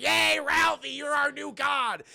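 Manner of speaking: high, loud crowd cheer; acted hurrah for a new idol; worshipful cheering. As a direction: cheer it out, stretching the end. Imagined classmates cheer and worship.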